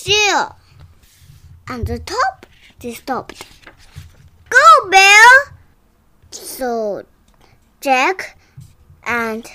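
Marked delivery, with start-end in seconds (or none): 4.5-5.3 loud cheer. Acted. Cheering in story.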